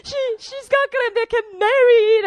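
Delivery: high, loud wailing mother and aunt; 통곡하는 이모 흉내; mock bawling aunt. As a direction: wail it like a distraught auntie. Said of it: "bawling on the phone".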